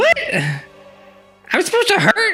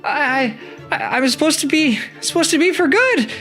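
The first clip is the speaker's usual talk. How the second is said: much higher, stammering worry; confused panic. Acted. Tisha unsure of her purpose, high anxious stammer. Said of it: "And little Tisha's like".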